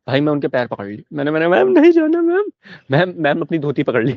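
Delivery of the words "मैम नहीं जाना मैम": desperate plea. Retold or imitated. Clutching teacher's feet, begging.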